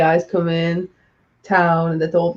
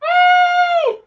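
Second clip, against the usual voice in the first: a high, bright yelled hey; loud greeting; 4 dB louder than usual. Real, not acted. Greeting Sean as he drops in, shouting hey. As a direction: call out a welcome.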